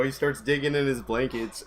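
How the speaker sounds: normal talking voice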